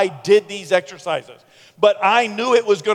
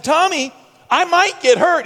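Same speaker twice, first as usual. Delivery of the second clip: high, loud mock alarm; alarmed protest; 11 semitones higher, 5 dB louder than usual. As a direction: plead with your buddy, pitched way up. Protesting to Tommy.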